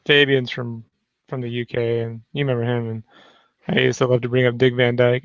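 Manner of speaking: normal talking voice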